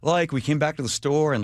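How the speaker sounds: ordinary talk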